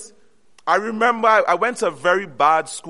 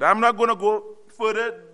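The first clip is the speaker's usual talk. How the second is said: ordinary delivery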